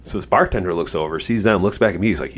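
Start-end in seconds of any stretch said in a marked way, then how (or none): none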